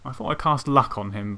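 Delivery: usual speaking voice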